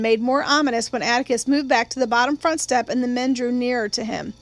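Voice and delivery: usual speaking voice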